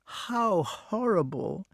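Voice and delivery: slow, high, broken lament; anguished, drawn-out dismay. A narrator's reading. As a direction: draw it out in horrified distress. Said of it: "said the wart in a broken voice".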